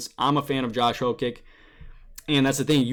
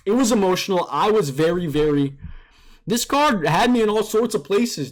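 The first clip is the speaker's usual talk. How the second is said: emotional; 6 semitones higher than usual. Real, not acted.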